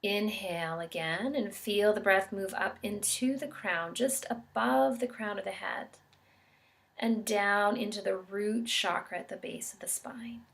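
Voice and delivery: calm, measured meditation guide with long pauses; guided meditation. Real, not acted. Yoga instructor guiding listeners into an expansiveness meditation, calm and unhurried. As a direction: guide them gently through each breath, leaving space between phrases.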